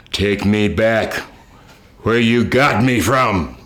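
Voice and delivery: put-on slow, drawn-out spooky baby voice; eerie ghost voice. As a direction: deliver the ghost's line slowly, chillingly. Ghost baby speaks, story punchline.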